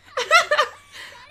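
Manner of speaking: high, loud brittle laugh; forced laugh. Acted. Edena laughing off Kei's accusation, forced high laugh.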